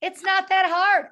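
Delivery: high, loud and slow, brushing it off; upbeat, confident. Retold or imitated. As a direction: cheerfully wave off the difficulty. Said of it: "the soul who says".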